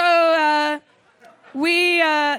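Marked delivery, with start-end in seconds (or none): none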